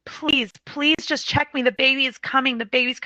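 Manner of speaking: high, desperate retold plea; imitating a labouring mother's urgent pleading; begging. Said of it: "she starts begging him".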